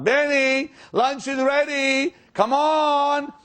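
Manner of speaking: hammed-up hostess shouting up the stairs; retold calling out. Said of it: "kept screaming out".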